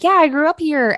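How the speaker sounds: upbeat doorstep hello; bright friendly reply. Retold or imitated. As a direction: chirp it like greeting a neighbor at the door. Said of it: "being like".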